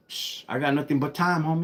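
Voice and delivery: ordinary talk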